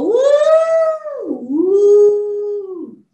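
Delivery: long, loud, high whoops; playful drawn-out "woooo"; excited woo. Real, not acted. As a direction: whoop as if petting something lovely. Feeling the lamb's woolly coat.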